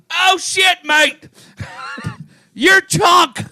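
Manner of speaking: put-on English accent. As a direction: shout it in a hammed-up English accent. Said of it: "somebody's like".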